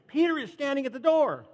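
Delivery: high excited cry of the news; overjoyed announcement. A narrator's reading. Servant girl Rhoda telling everyone, high overjoyed. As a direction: burst in with joy. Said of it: "ran back inside and told everyone".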